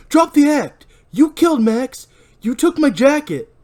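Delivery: very high, loud cutting snap; angry accusation. Acted. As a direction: cut him off hard. Barry cuts Ray off.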